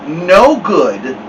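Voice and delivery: usual speaking voice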